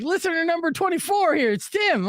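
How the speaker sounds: high, excited listener introducing himself; eager fan. Retold or imitated. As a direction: proudly announce yourself to the DJ. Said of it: "And he's like".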